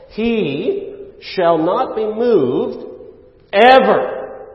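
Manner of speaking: "ever" set apart after a pause, high and loud; slow, loud line, then hit "ever" hardest; stress on ever. Shown as a demonstration. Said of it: "with the emphasis on the last Hebrew word".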